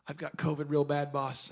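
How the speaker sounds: low, soft sickly voice; imitated sick employee. Employee phones the boss with covid, feebly. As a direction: report sick, low and quiet.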